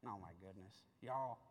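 hushed awe at the revelation; awed hush. Real, not acted. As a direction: let the wonder drop you to a hush. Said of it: "preacher overcome".

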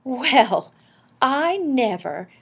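high, loud, slow amazement; very impressed. A narrator's reading. Wife learning of husband's letter, very much impressed. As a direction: marvel with drawn-out words. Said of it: "very much impressed".

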